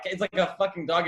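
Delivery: ordinary talk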